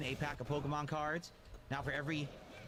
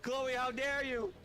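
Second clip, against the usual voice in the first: melodramatic reproach; 7 semitones higher than usual. Acted. Voicing his character reproaching Chloe.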